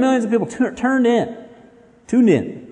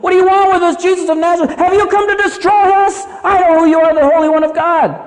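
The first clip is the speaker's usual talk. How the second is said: high, loud panicked demon cry; terrified scream; 8 semitones higher, 4 dB louder than usual. Acted. Terrified demon confronting Jesus of Nazareth, acted fearful scream. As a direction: scream it out in fear at a raised pitch. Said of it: "in s-, fear, he screams".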